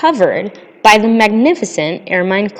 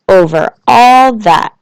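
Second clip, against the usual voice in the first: slow, louder Over all that falling firmly; grand confirmation; 5 dB louder, slower than usual. A narrator's reading. King to the little prince, slow firm reply. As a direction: settle it with royal certainty.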